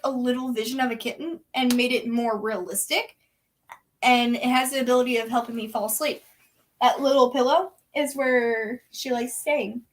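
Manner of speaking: usual speaking voice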